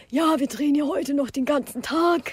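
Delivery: put-on voice of a woman informing onlookers; imitating set assistant. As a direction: play the chatty crew woman with the clipboard. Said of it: "Sie hat bestimmt so geredet".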